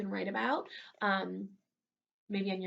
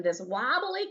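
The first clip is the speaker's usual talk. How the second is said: ordinary delivery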